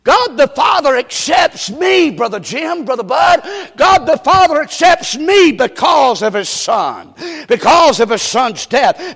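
loud, driving preacher's cadence; preaching at full force. Acted. Preacher proclaiming acceptance to the church, driving shout. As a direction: build it to a shout.

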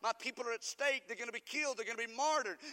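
crying out to God. Acted. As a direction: Esther begging for her people. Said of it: "crying out to God".

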